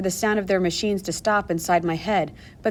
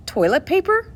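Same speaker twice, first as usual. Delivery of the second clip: surprised question; 9 semitones higher than usual. A narrator's reading. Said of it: "the nurse asked".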